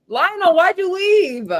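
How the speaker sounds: slow, high call for a friend who left. Real, not acted. Calling after a friend who dropped off the stream. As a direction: stretch the name out, asking where he went.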